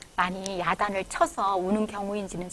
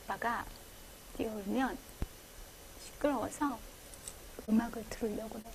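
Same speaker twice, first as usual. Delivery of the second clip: barely audible, much softer; 16 dB quieter than usual. Shown as a demonstration. A father quietly telling the crying child he'll put on music, very soft. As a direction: murmur it so quietly it's almost lost. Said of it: "조그만 목소리는".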